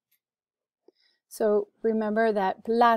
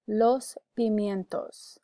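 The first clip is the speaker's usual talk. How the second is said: "los pimientos" slowly, syllables clear; slow, deliberate "los pimientos"; careful pronunciation model; slower than usual. Shown as a demonstration. Teacher gives the last word on the list, slow careful model. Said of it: "You can repeat for pronunciation".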